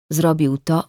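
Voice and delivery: ordinary delivery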